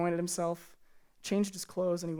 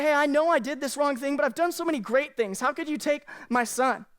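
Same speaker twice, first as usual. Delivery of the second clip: mock protest; 6 semitones higher, 3 dB louder than usual. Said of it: "to be mad at God, to say".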